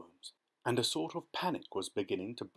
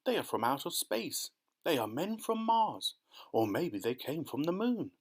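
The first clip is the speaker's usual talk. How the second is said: excited crowd shouting; 6 semitones higher than usual. A narrator's reading. Onlookers guess where the visitors are from, excited calling. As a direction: voice a mildly hammed-up crowd guessing wildly. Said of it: "they shouted".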